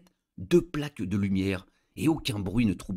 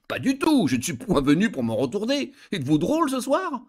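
high, bright-eyed surprise, much higher; surprised; 8 semitones higher than usual. A narrator's reading. Wife answering her husband's odd dare, surprised and higher. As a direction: toss it back, astonished. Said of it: "Je répondis fort surprise".

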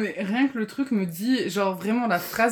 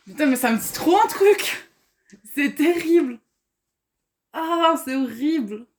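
loud, higher frustrated outburst; exasperated; 6 semitones higher, 6 dB louder, slower than usual. Real, not acted. Player cannot place a familiar book, loudly exasperated. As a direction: cry out in agonized recognition.

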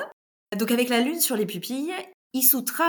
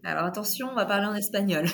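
usual speaking voice

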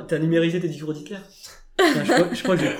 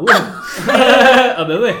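laughing; 6 semitones higher, 12 dB louder, much slower than usual. Real, not acted. Laughing loudly at friend's remark.

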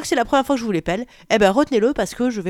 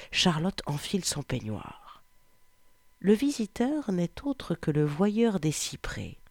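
story narration; 4 semitones lower than usual. A narrator's reading. Reading her chosen erotic novel excerpt to listeners, low and hushed. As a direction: read the erotic story intimately.

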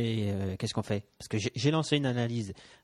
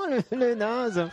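mocking laugh; 12 semitones higher, 5 dB louder, much slower than usual.

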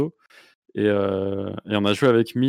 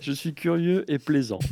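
mocking imitation; 5 semitones higher than usual. Parroting guest's self-description.

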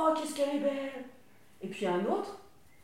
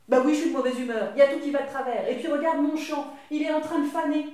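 louder, cross grandma complaining; grumpy grandmother; 3 semitones higher, 7 dB louder than usual. Acted. Grandmother grumbling to Tom about her field. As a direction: grumble it all out, fed up.

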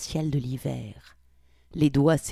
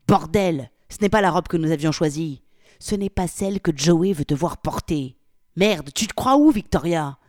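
loud, furious scolding; angry rant; 7 dB louder than usual. Acted. Polly berates Victoria over the dress, loud furious scolding. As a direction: tear into her, loud.

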